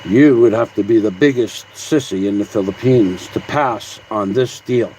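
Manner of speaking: low, self-important movie-actor voice; mock radio announcer. Reading out a critic's comment like a movie actor, mock announcer voice. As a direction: ham it up like a would-be radio announcer. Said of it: "I'm a movie actor, like that guy who thinks he's a radio announcer".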